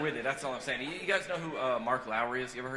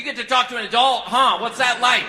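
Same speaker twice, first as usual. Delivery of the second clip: high, loud, exasperated mock jealousy; sarcastic envy; 11 semitones higher, 16 dB louder than usual. Mother of three kids to man in rehab, sarcastic envy. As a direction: needle them loudly, pitched way up.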